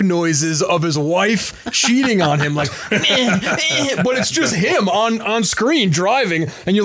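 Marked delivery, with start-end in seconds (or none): 2.9-4.0 mock moaning. Imitating movie sex noises.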